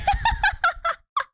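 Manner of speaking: high, loud burst of laughter; big high-pitched laugh; real laughing fit. Real, not acted. Laughs at own super glue warning.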